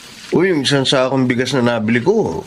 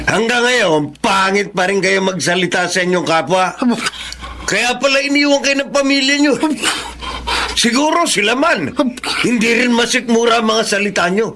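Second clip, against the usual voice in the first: high, raised cutting rebuke; scolding; 9 semitones higher than usual. Acted. Sister scolding the rude neighbor, with rising anger. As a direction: cut him down with scorn.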